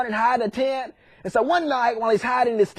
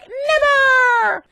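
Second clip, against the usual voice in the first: very high, sustained mock villain cry; hammed-up defiant shout; 13 semitones higher, 3 dB louder than usual. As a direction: stretch the refusal into one long shriek. Pharaoh refuses Moses.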